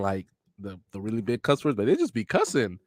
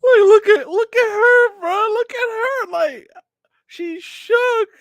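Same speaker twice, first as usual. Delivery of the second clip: very high, loud, laughing-crying voice; high squealing laugh talk; laughing squeals; 7 dB louder, slower than usual. Real, not acted. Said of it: "laughing at Sakura's reaction".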